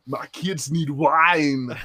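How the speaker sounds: put-on slow Arnold Schwarzenegger-style Austrian accent. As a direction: growl it from the back of the throat like Arnold. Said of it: "Arnold Schwarzenegger's Austrian".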